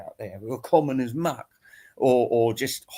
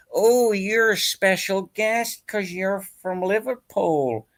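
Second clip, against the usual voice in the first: mock fawning welcome; 7 semitones higher, slower than usual. Mocking people who fawn over him.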